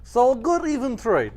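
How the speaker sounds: high, slow mock Russian accent; Soviet accent. Co-host caps the potato joke in mock Soviet accent. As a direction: stretch it out as a pleased comic Soviet. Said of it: "talks like somebody that lived in the, like, Soviet Union".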